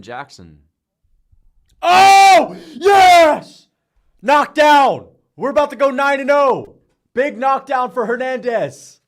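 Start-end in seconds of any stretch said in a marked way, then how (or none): 1.8-9.0 very high, very loud knockout yell; ecstatic screaming. Real, not acted. Streamer seeing a head kick knockout, ecstatic screaming. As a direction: scream as the fighter drops.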